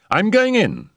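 high-pitched, decisive call; urgent Settle. A narrator's reading. Settle about to dive into the pond, urgent. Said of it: "he said".

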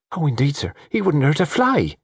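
high-pitched, earnest servant's plea; high, deferential protest; anxious pleading servant. Acted. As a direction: plead high for someone's innocence.

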